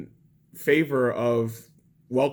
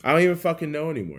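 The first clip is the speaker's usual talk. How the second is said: higher, louder and fast; exasperated outburst; 5 semitones higher, 5 dB louder, much faster than usual. Real, not acted. To viewers after whispering it, a fast exasperated outburst. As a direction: blurt it out, giving up. Repeats the line aloud.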